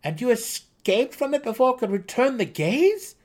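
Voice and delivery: high, loud eager gnome voice. A narrator's reading. Seldig eagerly asks the dark elf, high and loud. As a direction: ask it breathless with wonder. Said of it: "Seldig asked".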